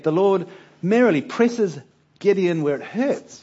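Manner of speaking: normal talking voice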